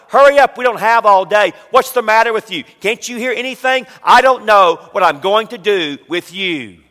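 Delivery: exasperated, loud parental tirade; angry parent. Acted. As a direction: snap scolding orders at a child. Acting out parents' harsh words.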